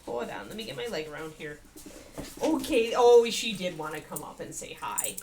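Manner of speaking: ordinary delivery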